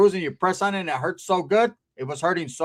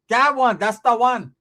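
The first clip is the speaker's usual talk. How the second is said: slow, loud, raised exclamation; excited aunt imitated; 6 semitones higher, 5 dB louder, slower than usual. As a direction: seize on the right word, high. Aunt exclaims at right word.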